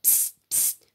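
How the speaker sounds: unvoiced psst into the schoolbag; whisper. A narrator's reading. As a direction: whisper right against the bag. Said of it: "j'ai chuchoté".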